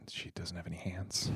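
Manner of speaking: low whispered aside. Real, not acted. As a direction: whisper it so she won't hear. Whispered aside about Kathy.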